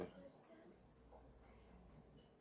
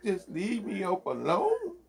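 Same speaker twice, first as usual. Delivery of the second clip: high wailing mock sob, laughing through it; mock crying. Mock crying woman begging to be left alone. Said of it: "she started crying".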